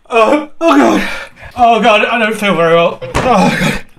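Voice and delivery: loud, high groans of nausea; fighting sickness, moaning and crying out; pained sick groaning; real distress. Real, not acted. Still in pain, feeling sick.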